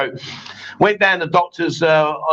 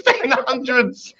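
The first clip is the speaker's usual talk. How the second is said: higher, drawn-out astonished echo; mock incredulity; 5 semitones higher, slower than usual. Real, not acted. Host still ribbing co-host's date, incredulous. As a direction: drag out every word in disbelief. Repeats the wrong date in disbelief.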